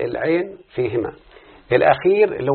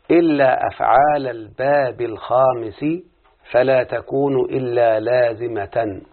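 slow, deliberate reading of the text; slow dictation reading; slower than usual. Shown as a demonstration. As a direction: read each phrase slowly for students. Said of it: "نريد أن نفهم هذا الكلام".

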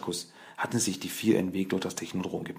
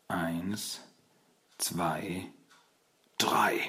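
calm counting; much slower than usual. A narrator's reading. Splinter counting down for the Turtles' strike, calm. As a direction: count evenly and unhurriedly. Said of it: "Splinter zählte ruhig".

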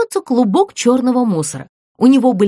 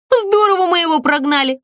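cheerful; 9 semitones higher than usual. A narrator's reading. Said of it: "весело сказала Злюня".

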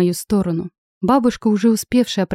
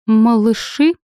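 изумлённо; 3 semitones higher, 4 dB louder, slower than usual. Acted. Все поражены новостью.

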